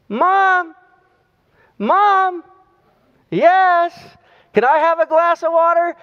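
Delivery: put-on high small boy's voice calling out from bed; little boy calling. Little boy calling his mother from bed, high childlike voice. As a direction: pitch way up like a kid calling his mom.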